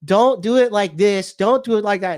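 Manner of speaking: a mocking impression of a girl whining about the gingerbread house; whining girlfriend. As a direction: imitate her fussy instructions, whining. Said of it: "And she started whining".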